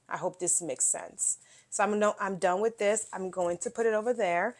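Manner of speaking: ordinary talk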